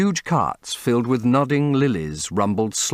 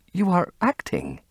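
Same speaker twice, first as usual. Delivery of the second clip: put-on high, hurt young woman; a wounded young actress; 11 semitones higher than usual. Sibyl voiced, high tone.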